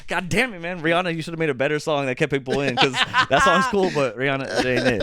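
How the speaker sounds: high-pitched, half-laughing mock rant; pissed off. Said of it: "I was so pissed".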